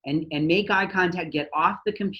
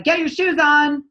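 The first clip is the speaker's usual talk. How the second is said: loud, much higher, slow yell; mock yelling parent; 9 semitones higher, 7 dB louder than usual. Parent yelling at kids from the kitchen, loud and slow. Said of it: "in the kitchen and we're yelling like".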